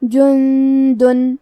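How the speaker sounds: "جندن" chanted slowly, the first N held as a nasal hum; drawn-out tajweed "جندن" with humming N; ghunnah demo. Shown as a demonstration. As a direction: hide the noon in "جندن" in a long hum before D. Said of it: "حکم کو غنہ کے ساتھ".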